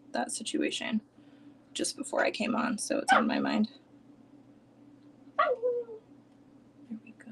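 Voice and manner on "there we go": whisper. Real, not acted. Settling in, soft aside.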